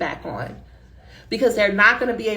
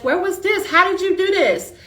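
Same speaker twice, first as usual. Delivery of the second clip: delighted surprise; 8 semitones higher than usual. Retold or imitated. Chair reading the improved draft, high amazed delight. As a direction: pitch jumps way higher, amazed and thrilled.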